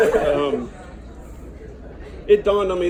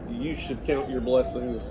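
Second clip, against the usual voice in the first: ordinary talk, nothing marked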